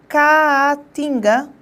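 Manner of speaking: "Caatinga" stretched very slowly so both A's are heard; drawn-out pronunciation. Shown as a demonstration. Teacher showing students the hiatus, very slow and drawn out. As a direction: linger on the double vowel in "Caatinga". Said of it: "Olha só".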